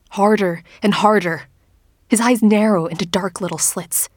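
ordinary delivery, nothing marked

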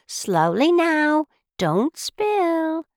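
high, slow, careful coaxing; motherly teapot. Acted. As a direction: coax gently in a high, drawn-out voice. Teapot coaxes while pouring tea.

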